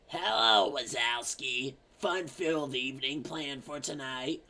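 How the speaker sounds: lightly voiced monster office lady, slow on the name; a hint of the slug lady's drawl. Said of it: "That lady's like".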